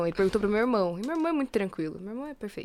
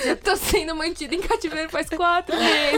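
high, louder fake desperate begging with laughs; mock hostage plea; 7 semitones higher, 3 dB louder than usual. Fiancée jokingly begging listeners to rescue her, laughing mock plea. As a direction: ham up a captive pleading for rescue.

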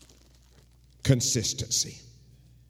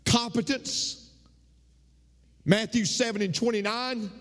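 high, ringing preacher's voice, pitched way up; full-force preaching; 11 semitones higher than usual. Acted. Pastor exhorting the men of the congregation, full-force preaching. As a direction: preach it out to the whole church.

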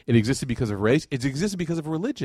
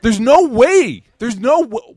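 emphatic no way; 8 semitones higher, 9 dB louder, much slower than usual. Real, not acted. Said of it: "I'm doing it again".

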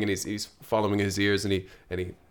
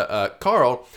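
usual speaking voice

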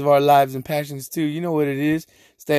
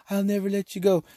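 Rose; 6 semitones higher, 5 dB quieter than usual. Retold or imitated. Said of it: "Rose is a sorry".

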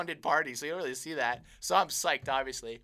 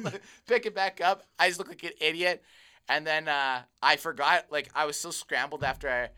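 ordinary delivery

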